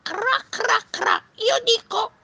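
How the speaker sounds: very high, loud frog voice; croaking frog. Acted. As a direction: croak like a shrill little storybook frog. Frog voice in read story. (vocina acutissima da rana)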